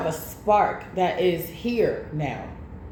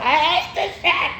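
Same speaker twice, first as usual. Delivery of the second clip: laughing mock scream; 8 semitones higher than usual. Retelling screaming at cousin.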